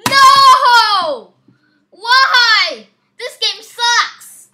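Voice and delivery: angry outburst at the screen; gamer rage. Real, not acted. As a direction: blow up at the game. Gamer rages at failure.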